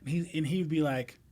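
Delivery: ordinary delivery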